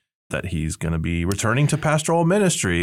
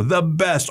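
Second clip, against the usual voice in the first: "the best" pitched high and emphatic; all-caps emphasis; 7 semitones higher than usual. Retold or imitated. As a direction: punch out "the best" as if capitalized. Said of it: "all caps".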